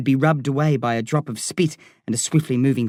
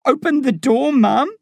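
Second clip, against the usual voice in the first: high, strained begging; desperate spilling plea; 10 semitones higher, 3 dB louder than usual. A narrator's reading. Crying boy at mum's cell door, pleading. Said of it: "I spill".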